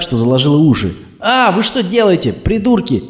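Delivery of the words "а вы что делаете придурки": high, startled yell at the thugs; woman yelling. Acted. Woman driver yells at thugs revving beside her. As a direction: yell it as they rev the engine. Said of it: "закричала она".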